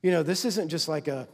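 ordinary delivery

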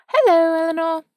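high, loud London socialite greeting; chic London accent. A narrator's reading. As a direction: call out hello in an overly chic London accent. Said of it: "she said in her too chic for this place London accent".

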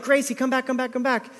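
high, urgent calling without real volume; mock yelling. Owner calling a runaway dog back, mock yelling. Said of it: "like, yelling. We're losing our cool".

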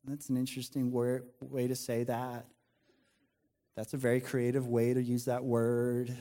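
politely gloss over someone's crude language; acted polite smile. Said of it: "just smiling".

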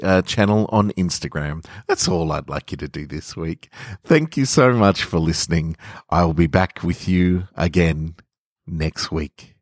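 ordinary delivery, nothing marked

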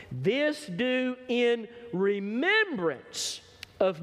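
very slow, drawn-out words; slow emphasis. Acted. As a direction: stretch every word out for weight. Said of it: "Watch this".

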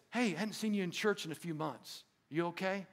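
gentle check-in. Acted. Checking on absent church member.

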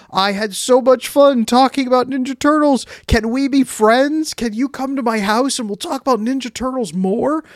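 put-on a playground kid gushing about a shared love; excited little kid. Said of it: "the way a five-year-old who finds someone on the playground says".

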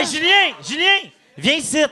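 shouting his name across the room; calling out. Real, not acted. Calling a guest over to sit.

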